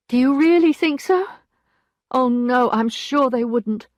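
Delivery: 1 despairing doubt. A narrator's reading. Emma rejecting each name.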